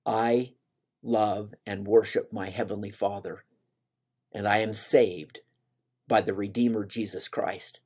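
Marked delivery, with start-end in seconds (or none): none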